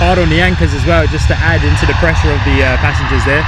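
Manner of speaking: loud, eager repeat of the landing call; loud live plane-spotting call; excited commentary. Real, not acted. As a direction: call it out again just as hyped.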